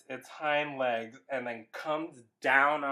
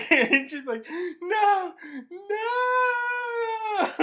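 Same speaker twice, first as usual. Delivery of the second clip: high, loud laughing squeal; laughing so hard it squeals; laughing cry; 6 dB louder, much slower than usual. Real, not acted. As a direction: laugh through a mock scream.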